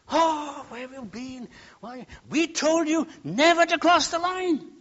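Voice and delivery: high-pitched, acted parental telling-off; scolding parent. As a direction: scold a child who wandered off. Scolding parent voiced in story.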